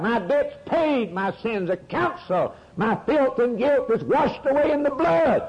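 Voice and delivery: high, ringing testimony; a preacher exulting in his assurance before the congregation; triumphant preaching. Acted. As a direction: proclaim it in triumph.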